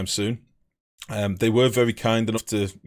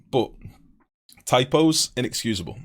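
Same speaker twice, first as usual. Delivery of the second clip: ordinary talk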